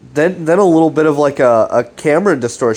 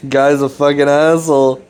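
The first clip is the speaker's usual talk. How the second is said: ordinary talk, nothing marked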